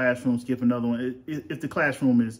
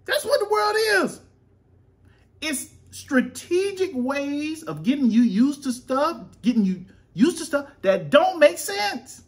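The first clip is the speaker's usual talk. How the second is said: mock conspiracy preaching; 14 semitones higher, 4 dB louder, slower than usual. Mock preaching about the world.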